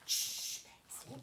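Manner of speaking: ordinary talk, nothing marked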